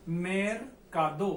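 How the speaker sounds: मेरकादो drawn out slowly, each syllable clear; slow pronunciation. Shown as a demonstration. Teacher shows students a new Spanish word, slow and careful. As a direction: stretch मेरकादो slowly so every syllable is heard. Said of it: "अगला शब्द है अपने पास मेरकादो".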